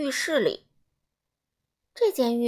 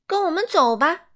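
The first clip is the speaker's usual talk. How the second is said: higher and louder, bossy and eager; cheerful urging; 7 semitones higher, 7 dB louder than usual. A narrator's reading. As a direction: sweep him along brightly. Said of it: "干净先生说". (高声、热情地催促)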